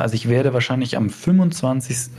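ordinary delivery

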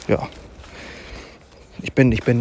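ordinary delivery

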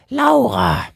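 put-on high old lady calling a name; old woman calls out loud. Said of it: "rief sie".

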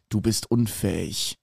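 low, slow, cold contempt; contemptuous. Acted. Master scorns his failed servant Garçon, low and cold. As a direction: look down on him and drop the words softly. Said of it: "sah Garçon unerbittlich an".

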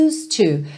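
"Used to" said as "iust-tu", the d dropped; used to linked. Shown as a demonstration. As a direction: glide "Used to" into one word with no d sound. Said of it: "cuidado pra você não cair na armadilha de falar used". ("Used to" sem o som do d)

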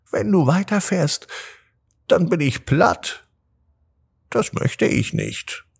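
hohe, kleine Käferstimme; ladybug voice. A narrator's reading. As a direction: winziges Tier bittet ängstlich. Marienkäfer warnt Madeleine. (hohe Marienkäferstimme)

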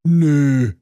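a flat bored refusal from the fire brigade; dismissive fireman's nö; firefighters. Acted. Firefighters answer on the phone.

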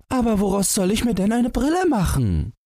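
put-on very high, plaintive child's voice. A narrator's reading. Son asks what to make glasses from, plaintive high voice. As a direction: plead pitifully as a small boy. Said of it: "fragte Thomas kläglich".

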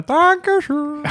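high, slow, distant call; as if called from far away; far-off thank-you. Pretend. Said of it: "irgendwo in der Ferne sagt einer".

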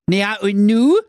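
high, loud clueless question; foolish Thorsten asking. Acted. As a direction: ask like a simpleton, pitched high. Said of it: "fragte der törichte Thorsten". (hohe, laute Frage des törichten Thorsten)

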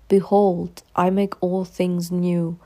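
slow, solemn proclamation; solemn biblical declaration. Shown as a demonstration. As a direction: proclaim it slowly and grandly. Quoted proclamation.

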